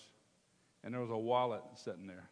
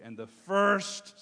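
"first" drawn out slow, high and loud; preacher hammering "first" for the congregation to repeat; stressed "first"; 7 semitones higher, 12 dB louder, much slower than usual. Shown as a demonstration. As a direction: lean hard on "first" and stretch it. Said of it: "somebody say first".

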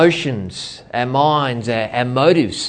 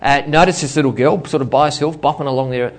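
ordinary delivery

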